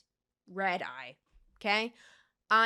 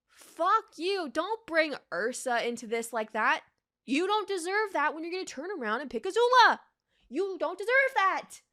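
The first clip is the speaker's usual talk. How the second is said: raised, heated rant at Zuko; angry rant; 6 semitones higher than usual. Real, not acted. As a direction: tell him off hotly. Ranting at Zuko.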